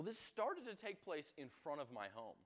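ordinary talk

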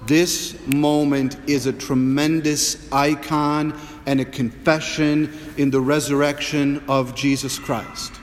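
normal talking voice

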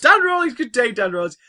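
high, loud cheery hail; hearty greeting. Pretend. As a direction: hail him like an old chum. Greeting a listener grandly.

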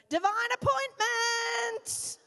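hammed-up heavenly shout; angels shouting. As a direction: cry it out like heaven laughing. Angels laughing at top of voices.

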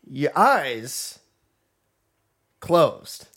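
halting delivery with long pauses; Christopher Walken. Acted. Teasing a friend about bedtime, a hint of Walken impression. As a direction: break the phrase oddly, lightly imitated. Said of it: "Why are you talking like Joaquin Phoenix?".

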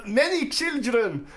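high, loud ranting dictator; Hitler voice. Acted. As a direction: rant like an old dictator. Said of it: "You, Hitler over there".

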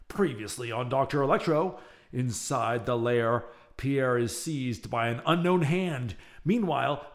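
ordinary delivery

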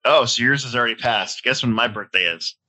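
usual speaking voice

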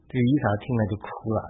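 ordinary delivery

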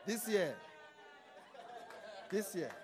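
laughing through it; laughing repeat. Retold or imitated. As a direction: chuckle while repeating.

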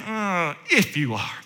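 doubting devil. Acted. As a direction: cast doubt in a low, quiet sneer. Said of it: "the devil's saying".

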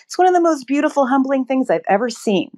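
moved, voice rising high and quick; genuinely choked up, words hurrying out high. Real, not acted. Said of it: "I'm getting choked up as I talk about it".

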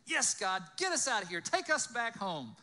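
loud, eager agreement; excited cheering. Acted. Moses urging God to take them home, loud and excited. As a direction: shout approval back at the promise.

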